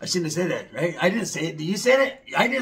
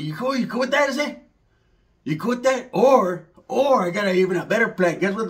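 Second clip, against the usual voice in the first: ordinary talk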